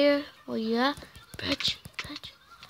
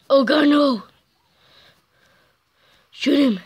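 ordinary delivery

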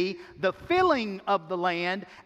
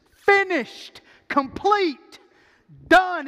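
high, loud, punched single words; emphatic; 9 semitones higher, 7 dB louder, much slower than usual. Real, not acted. Preacher driving the Hebrew emphasis home, emphatic and loud. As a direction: ring out each word as its own proclamation. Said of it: "for emphasis".

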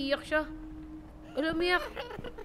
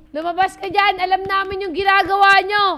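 calling out; 4 semitones higher, 9 dB louder than usual. Real, not acted. Calling out at hiding characters.

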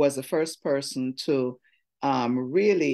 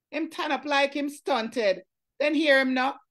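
lively, higher Jamaican Creole poem performance; poem reading; 10 semitones higher than usual. Acted. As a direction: perform the poem with relish and comic timing. Reads Louise Bennett Creole poem.

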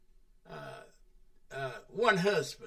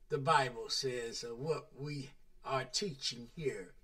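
ordinary talk, nothing marked

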